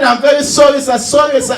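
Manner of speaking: hammed-up emotional sorry; emotional apology. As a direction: imitate someone gushing apologies. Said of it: "Americans are very emotional".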